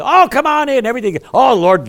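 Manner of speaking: high, loud gushing welcome. Retold or imitated. Pastor mocking modern churches' gushing welcome, high and loud. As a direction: gush it like an eager greeter. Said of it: "We say".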